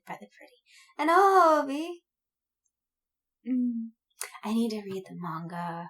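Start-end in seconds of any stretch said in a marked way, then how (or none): none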